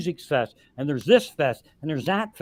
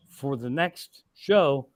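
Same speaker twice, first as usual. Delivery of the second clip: ordinary talk, nothing marked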